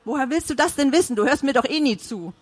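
wife firing back in fury; in a rage. A narrator's reading. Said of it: "konterte Mona in Rage".

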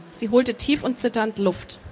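ordinary delivery

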